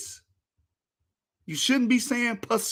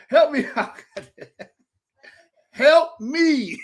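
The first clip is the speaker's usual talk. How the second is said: laughing, pleading for help; laughing appeal to Mari for help; laughing plea; 4 semitones higher, 5 dB louder, slower than usual. Real, not acted. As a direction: beg through laughter.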